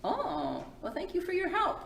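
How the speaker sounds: usual speaking voice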